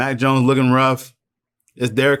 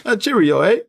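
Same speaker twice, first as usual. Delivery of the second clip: put-on British accent, high and chirpy; a quick hammed-up British cheerio; mock British; 9 semitones higher than usual. Teasing teammates back from London, mock British accent. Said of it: "come back with British accents".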